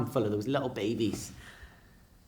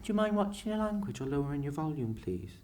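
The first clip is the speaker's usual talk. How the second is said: softer, fading to a timid please; polite prim request; 3 semitones higher, 7 dB quieter than usual. Retold or imitated. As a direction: ask primly and politely.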